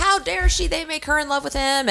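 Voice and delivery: high, indignant mock outrage; hammed-up indignant outburst. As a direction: act out the fury, half joking.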